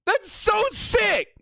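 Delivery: thrilled high shouts, slowing at the end; excited shouting. Real, not acted. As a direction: shout it like your team just clinched. Herbert reaches the postseason.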